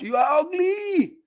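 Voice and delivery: high, slow yelled insult; hammed-up distant shout. Shown as a demonstration. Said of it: "and just shout".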